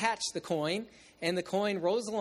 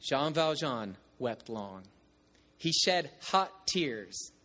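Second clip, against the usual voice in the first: slow, weighty reading of the text; slow dramatic reading of a novel; slower than usual. Shown as a demonstration. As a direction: let each word land, slowly. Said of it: "the book says this".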